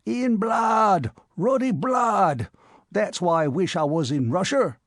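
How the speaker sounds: shriveled cabman repeating himself with grim relish; old cabman relishing. A narrator's reading. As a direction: linger on ruddy blood. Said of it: "said the mummy".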